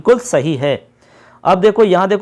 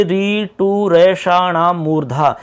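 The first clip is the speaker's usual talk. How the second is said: slow recitation; much slower than usual. Shown as a demonstration. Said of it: "अब आप देख लीजिए".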